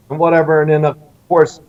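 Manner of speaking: ordinary delivery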